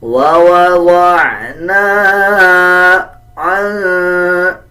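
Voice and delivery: वा वा वा ना with the ना held for a full beat; lengthened vowel. Shown as a demonstration. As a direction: stretch the ना for one second. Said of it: "इसको one second लंबा करेंगे".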